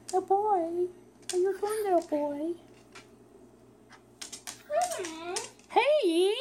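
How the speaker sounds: high sing-song pet voice. Real, not acted. Coaxing the bird to play, high baby talk.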